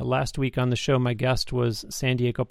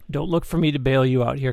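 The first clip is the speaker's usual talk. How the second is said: ordinary delivery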